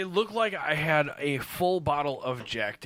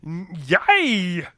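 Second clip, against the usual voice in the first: loud goofy cartoon cheer; cartoon character voice; 5 semitones higher, 9 dB louder, slower than usual. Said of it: "a character that [name] created".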